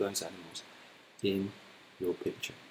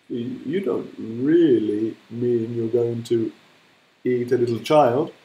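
louder, slow stammering disbelief; horrified hippo; 9 dB louder than usual. Acted. Hippo realising the crocodile eats children, slow horrified stammer. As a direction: stammer out the horror of it. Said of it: "said Humpy Rumpy".

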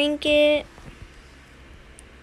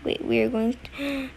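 usual speaking voice

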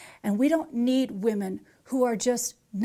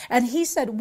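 usual speaking voice

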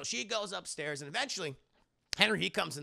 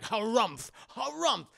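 loud, higher-pitched harumphing; grumpy curmudgeon; 6 semitones higher, 7 dB louder, slower than usual. Acted. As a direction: harumph like an old grouch. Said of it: "sitting there like a curmudgeon".

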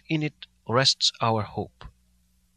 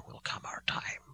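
whisper; 15 dB quieter than usual. Acted. Professor calms Jonathan.

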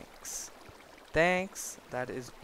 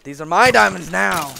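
much louder possessive shout; loud claim; 3 semitones higher, 13 dB louder than usual. Real, not acted. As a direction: bellow it to warn off the mobs. Guarding diamonds from mobs.

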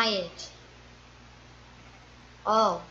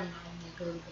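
said through a yawn; 4 semitones lower, 13 dB quieter, faster than usual. Real, not acted. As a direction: yawn the words out. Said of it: "Sorry I yawned so weirdly".

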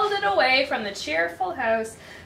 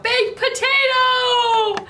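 high, loud, drawn-out holler; shouting out; 11 semitones higher, 7 dB louder, slower than usual. Acted. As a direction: holler it like the lady calling her dog. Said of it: "the lady kept shouting out".

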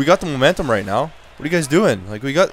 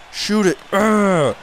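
ordinary delivery

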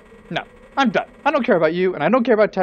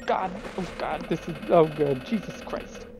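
softer, half-whispered frantic praying; panicked whisper; 2 semitones lower, 8 dB quieter than usual. Acted. Lineback dreading his fate, hushed frantic mutter. Still panicking about the whale.

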